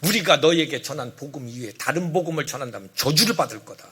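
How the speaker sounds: loud, emphatic warning settling into a grave close; stern, forceful apostolic decree; resolute. Acted. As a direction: pronounce the curse with total firmness. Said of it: "아주 그들에게 단호하게 얘기해요".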